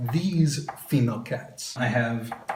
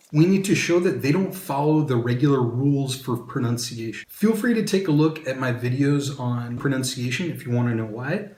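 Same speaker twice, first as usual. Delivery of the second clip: ordinary talk, nothing marked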